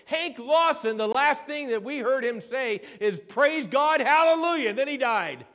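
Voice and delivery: high, loud preacher building to a hallelujah; fervent sermon climax; impassioned preaching. Real, not acted. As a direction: drive each name home to the congregation. Preaches at full force.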